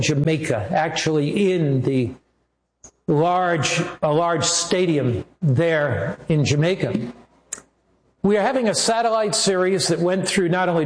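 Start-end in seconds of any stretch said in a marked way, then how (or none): none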